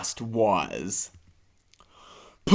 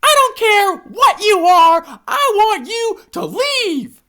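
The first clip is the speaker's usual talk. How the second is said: very high, loud, slow insistence; annoyed hippo; 4 dB louder than usual. Acted. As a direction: squeak out your patience is gone. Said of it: "Annoyed that the alligator isn't leaving".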